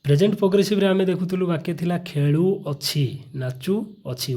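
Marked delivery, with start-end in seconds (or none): 2.0-4.4 ଖେଳୁଅଛି stretched slowly so ଉ ଅଛି stands out; slow teacher's demo of ଖେଳୁଅଛି; slow drawn-out endings. Shown as a demonstration. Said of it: "ଉ ଅଛି".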